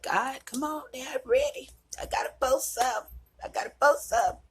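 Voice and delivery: high, eager and urgent; begging to get going; impatient pleading to God. Retold or imitated. To God, eager to post: high impatient plea. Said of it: "I was like".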